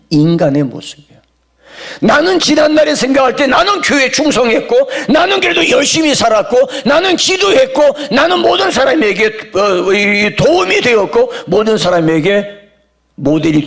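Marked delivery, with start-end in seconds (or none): 2.0-13.6 impassioned preaching. Acted. Preacher mocking his own past boasts, high and impassioned. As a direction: pile up each boast with rising force.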